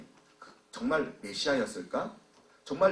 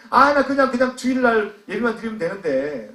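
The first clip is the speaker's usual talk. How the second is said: loud, raised grumble easing off; aggrieved complaint; 7 semitones higher, 10 dB louder than usual. Acted. Weary church worker complaining about extra duties, aggrieved. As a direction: protest loudly, then let it settle. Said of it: "마음에 불편함이 생길 수 있어요".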